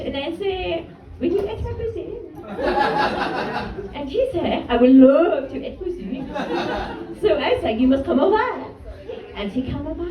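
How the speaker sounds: put-on French-accented raunchy storytelling; Ness. French friend boasts about her Hinge date, French accent. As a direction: savor each detail in a French accent. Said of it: "in my normal voice".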